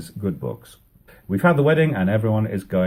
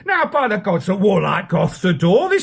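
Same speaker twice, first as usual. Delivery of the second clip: angry defiance; 11 semitones higher than usual. Acted. Rival prince shouts back.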